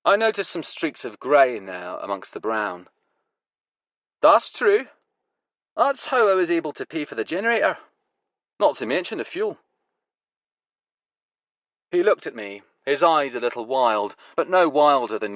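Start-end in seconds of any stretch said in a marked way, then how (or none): 4.2-9.6 raised, lively man's voice; proud grower explaining his setup; bragging farmer voice; Huey's voice. Acted. Huey talks about his generator.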